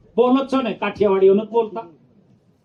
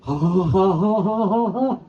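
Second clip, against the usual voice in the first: laughing; slower than usual. Real, not acted. Preacher laughs at own story.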